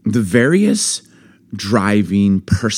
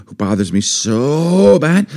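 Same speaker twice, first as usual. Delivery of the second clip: usual speaking voice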